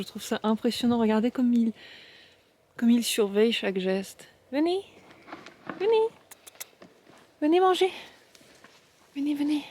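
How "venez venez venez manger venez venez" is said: high-pitched coaxing call; calling animals to food. Real, not acted. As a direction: call the flock sweetly, high up. Calling the sheep to eat. (appel aigu aux moutons)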